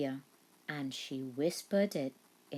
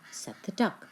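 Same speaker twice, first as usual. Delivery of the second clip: ordinary delivery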